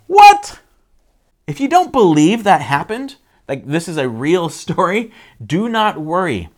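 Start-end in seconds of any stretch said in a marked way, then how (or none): 0.1-0.6 very high, loud "what?!"; hammed-up shocked squawk; incredulous yelp. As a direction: burst out in mock disbelief. Mock disbelief at water walking.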